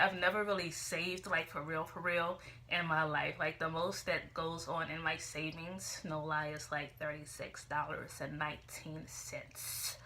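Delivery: ordinary talk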